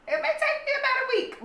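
giggling at her own delay; laughing. Real, not acted. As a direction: laugh through the admission. Joking about slow comment replies.

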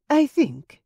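high, bright interruption; sister cutting in. Acted. Marie cuts off tense talk.

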